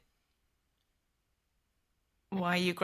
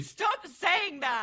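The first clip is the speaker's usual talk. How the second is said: higher, slow, each word bitten off; sharp angry rebuke; 9 semitones higher, much slower than usual. Acted. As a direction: cut the apologies short, hurt and furious. Snaps at repeated apology.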